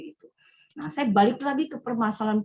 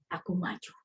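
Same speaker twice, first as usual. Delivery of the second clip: whisper; 4 semitones lower, 8 dB quieter than usual. Pretend. Whispered line inside prayer.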